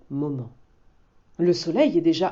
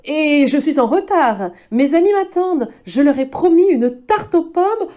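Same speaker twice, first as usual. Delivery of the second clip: much higher, louder happy wolf; cheerful hurry; 9 semitones higher, 7 dB louder than usual. Acted. Loup says his friends wait, cheerful and high. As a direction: rush off gladly.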